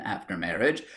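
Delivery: ordinary delivery, nothing marked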